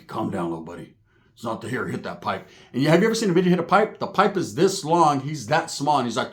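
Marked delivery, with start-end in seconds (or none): 0.1-2.5 low, soft calming murmur; hushed soothing. Retold or imitated. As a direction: hush the little guy down gently. Roach soothing, said twice.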